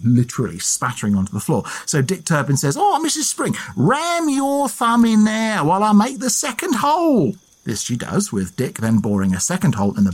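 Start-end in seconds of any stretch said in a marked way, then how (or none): 2.8-7.4 hammed-up Essex accent, much higher; a cheeky Essex rogue selling a trick, pitched high. Dick Turpin conning the landlady, high hammed-up Essex accent. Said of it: "I'm doing an Essex accent".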